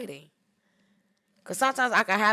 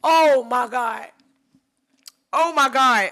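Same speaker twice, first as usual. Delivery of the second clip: awed; 3 semitones higher, 14 dB louder, slower than usual. Real, not acted. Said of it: "I'm yelling and screaming".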